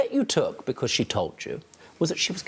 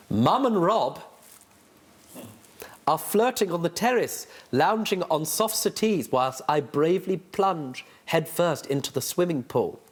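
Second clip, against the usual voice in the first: reading the novel aloud with dry relish. Acted. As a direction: judge reads the novel aloud in court. Judge reads from the novel.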